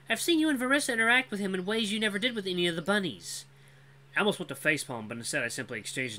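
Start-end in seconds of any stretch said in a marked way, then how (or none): 0.1-3.4 high, confident female voice; knowing young woman. Acted. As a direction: call him out knowingly. Cora confronts Vul.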